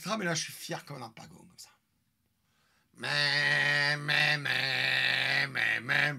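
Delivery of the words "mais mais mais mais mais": low sputtering engine sound made with the voice; mock engine noise. Revving the scooter like a bike.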